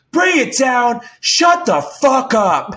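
high, drawn-out mock scream; retold yelling. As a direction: throw it back at him, yelling. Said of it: "this yelling went on".

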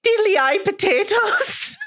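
high, slow hammed-up Irish lilt; mock stage-Irish accent. Host quoting what others call the accent, hammed-up Irish lilt. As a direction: sing it out like a cartoon leprechaun. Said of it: "they called that accent".

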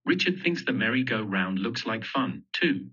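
usual speaking voice

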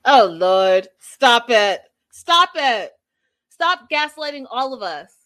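loud exasperated outburst, easing off; real exasperation, bursting out then tapering. Real, not acted. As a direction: protest as if you've had enough. Host rebukes Jesse's calm claims.

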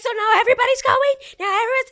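high, loud wailing protest; hysterical outburst. Retold or imitated. Niece feeling left out of the trip, hysterical shouting. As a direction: yell it like a hammed-up tantrum.

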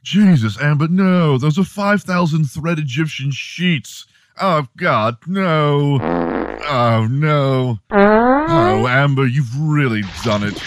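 high, horrified British protest; [name], fake British accent. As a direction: wail in mock horror with a British accent. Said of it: "your fake British accent".

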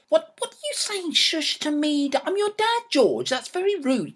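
high-pitched, affronted protest; indignant. Acted. Dad telling George off for shushing him, indignant. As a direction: object in offended disbelief.

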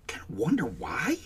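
a pointed whispered aside; sarcastic whisper. Real, not acted. Host whispering a suspicious aside to viewers. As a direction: breathe it out as a knowing whisper.

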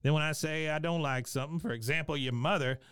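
imitated cantankerous piping-up; Cotton. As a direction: pipe up with a needling complaint. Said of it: "Cotton pipes up again".

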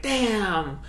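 a high, hushed "Damn!" full of awe; mock commentator awe; hyped announcer. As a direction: gasp it like a commentator who can't believe it. Mimicking hyped fight announcers.

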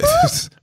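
very high laughing squeal; shrill laugh. Real, not acted. Laughter at joke.

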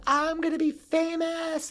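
high, grand, dreamy declaration of future fame; starlet proclamation. Retold or imitated. Teen girl at the boat's helm announcing future stardom, high and grand. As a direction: sing it out like a teen diva at the bow. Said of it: "she was like".

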